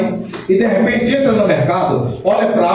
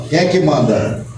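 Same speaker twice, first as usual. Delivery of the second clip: lightly voiced God on his throne; God's voice; 7 semitones lower than usual. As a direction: answer as God, calmly and firmly. God answers the prayer.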